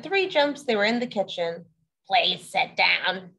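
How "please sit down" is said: slow, patient mother frog. Acted. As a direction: ask them to sit, slowly and kindly. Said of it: "she said".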